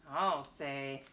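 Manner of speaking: ordinary delivery, nothing marked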